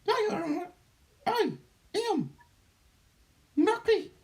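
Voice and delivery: high alien reptilian voice; otherworldly being, high and strange; Doctor Nucky. Acted. Channeled being introduces itself.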